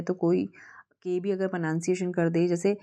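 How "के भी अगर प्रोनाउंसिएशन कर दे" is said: ordinary talk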